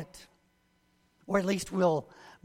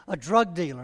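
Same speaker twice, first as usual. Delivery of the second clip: ordinary delivery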